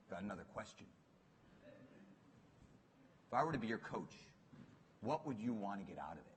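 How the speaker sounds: a hint of a probing mentor, low and quiet; Bill Campbell leaning in. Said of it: "he leans in".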